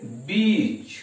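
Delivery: बीच with a long held ee; teacher demonstrates बीच with a long vowel. Shown as a demonstration. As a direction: draw out the ee in बीच. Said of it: "ऐसे long sound आता है".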